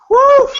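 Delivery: very high, loud whoop; high whooping "whew". Real, not acted. Reacting to Alexa looking fine, high whoop. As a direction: let out a high, admiring whew. Wordless exclamation praising Alexa's looks.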